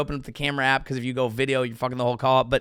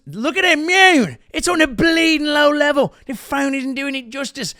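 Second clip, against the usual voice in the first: much higher, louder mock Irish; McGregor impression; 11 semitones higher, 5 dB louder than usual. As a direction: play McGregor high and excited. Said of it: "what Conor McGregor is doing".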